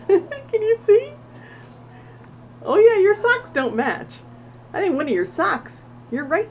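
high, loud delighted pet voice. Real, not acted. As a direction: squeal it at the cat. Delighted talk to the cat.

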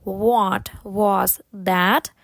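very slow, drawn-out words. Shown as a demonstration. Teacher has learners repeat slowly first, very slow. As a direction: stretch each word and pause between them. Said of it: "Можете повторити повільно".

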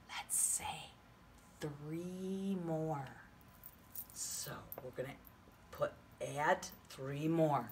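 hushed suspense; whispering. Shown as a demonstration. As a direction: whisper it like a secret. Teacher drops to whisper.

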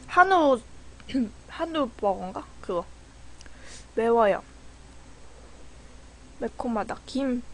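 talking between bites; eating spicy food; mouth full, chewing. Real, not acted. Seen 1: Chopsticks with food at lips, eating throughout the labelled words.

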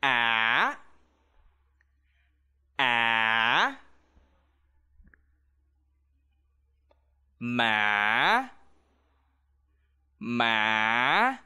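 á and má dip low, then rise; low rising tone. Shown as a demonstration. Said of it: "lowering your voice and rising your voice".

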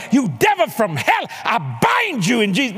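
re-enacted fervent prayer shout; screamed rebuke. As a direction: cry out a binding command. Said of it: "I'm screaming".

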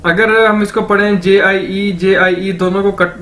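ordinary talk, nothing marked

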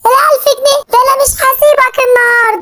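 distressed wail over the lost money; crying and yelling. Acted. Simba and mother over the lost money, crying shout.

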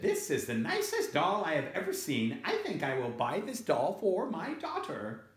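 high, sweet shopper voice; delighted lady picking a present; admiring lady. Acted. Second story lady admires doll.

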